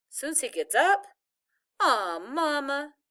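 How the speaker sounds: dismayed Southern daughter; upset, disappointed, Southern. A narrator's reading. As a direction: question it in disbelief, then a long, let-down "Aw". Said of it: "Mama sounded real upset and disappointed and Southern".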